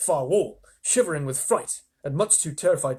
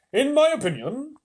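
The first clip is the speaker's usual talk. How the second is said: much higher, louder earthworm; 11 semitones higher, 6 dB louder than usual. Acted. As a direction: pronounce it high and lofty. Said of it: "the earthworm said".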